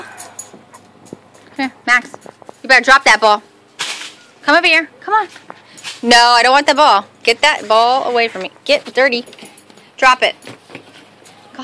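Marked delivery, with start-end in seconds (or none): none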